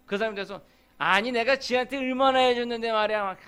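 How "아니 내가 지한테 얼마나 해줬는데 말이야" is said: louder, hurt and indignant; mock angry retort. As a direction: play the offended friend snapping back, a bit louder. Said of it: "이러면서 화내지 마시고".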